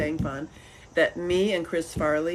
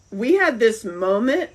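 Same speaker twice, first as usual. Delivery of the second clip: normal talking voice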